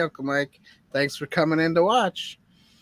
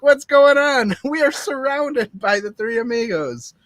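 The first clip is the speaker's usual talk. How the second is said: mock alarm; 8 semitones higher, 3 dB louder than usual. Quoting confused film characters.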